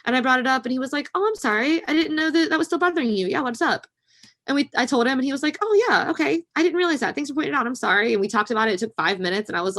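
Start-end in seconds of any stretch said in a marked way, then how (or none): 1.2-3.9 a higher, louder light take on the husband; light apologetic husband. Retold or imitated. Husband answers the wife without anger, light and apologetic. Husband's gentle reply voiced. 5.6-8.1 a higher, louder light take on the husband; light apologetic husband. Retold or imitated. Husband answers the wife without anger, light and apologetic.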